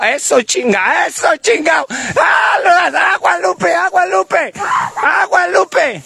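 high, drawn-out warning shouts; urgent calling out. Real, not acted. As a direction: call out in alarm to the driver. Urgently calling out to driver Lupe.

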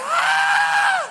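very high loud excited shout; crowd cheer. Retold or imitated. The people cheering the president, high loud shout. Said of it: "Y la gente".

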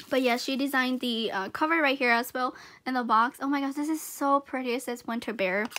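ordinary talk

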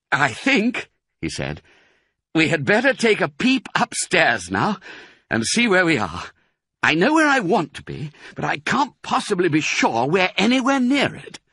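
lightly voiced careful father fox; lightly voiced Mister Fox. A narrator's reading. Mister Fox to his children, lightly voiced careful.

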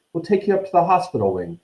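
fast and urgent; quickly. A narrator's reading. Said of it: "said Harry quickly".

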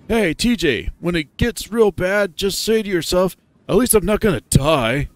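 high, loud, peppy character voice; loud, high-pitched pep talk; calling out encouragement. Acted. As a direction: shout a helpful tip over the crowd in a high voice. Another character calls advice to TJ.

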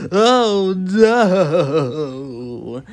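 loud, longer wavering wail of dismay; horrified wail. Acted. Mister Fussy takes in the bathroom mess, long horrified wail. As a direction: wail it out, stretching the last word. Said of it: "he gasped".

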